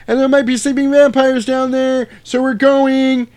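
high, loud, silly put-on voice; squeaky parody of a brave vampire hunter; high mock character voice. Voicing character in cave scene.

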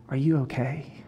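very soft, gentle check-in; tender question. Retold or imitated. Wife kneels and gently asks the troubled boy, very soft and tender. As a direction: ask it softly, almost under the breath.

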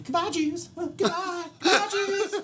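put-on high, bubbly little-girl voice; child actress. Imitating the bubbly child actress at her reading. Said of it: "some kid can".

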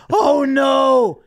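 high, loud shout of horror; mock panic. Realizing the bottle is gin, loud panicked shout. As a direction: yelp it as the gin hits. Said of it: "And I'm like".

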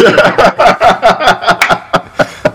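high, long fit of laughter. Real, not acted. Host cracking up at cohost's joke, long laugh.

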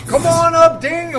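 loud, high game show call; game show host. Pretend. Price is Right host call.

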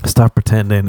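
usual speaking voice

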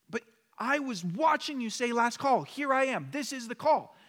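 high, pressed arguing voice; indignant protest. Retold or imitated. Traveler protesting to the gate agent, high and indignant. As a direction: plead your case, outraged and high.

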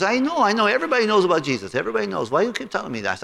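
high, fast dismissive owner; the owner brushing off talk about Jesus; mimicking Bali. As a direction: wave it off, high and quick.

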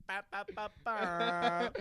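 laughing mock air horn blasts; imitating an air horn. Friends joking about his Scottish heritage, mock air horn. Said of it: "Air horn".

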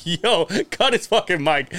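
high, slow, amused plea; mock urgent through laughter; laughing disbelief. Watching the singer, begging someone to cut him off, laughing. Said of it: "this is what I was like".

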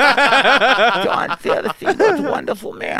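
loud, high, slow muffled boxer impression; Mike Tyson. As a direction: push it out high and loud into a chest. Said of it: "You hear muffled. You hear, just hear".

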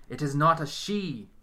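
loud, slow, cutting correction; sharp retort. A narrator's reading. Medium snaps at Orlando for his mistake, sharp. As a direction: snap back at him. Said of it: "Hazel snaps".

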